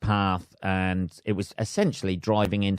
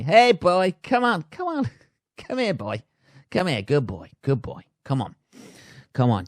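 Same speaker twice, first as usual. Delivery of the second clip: much higher, sing-song calling a dog; luring a dog; 6 semitones higher than usual. Pretend. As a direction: coax the dog in sweetly.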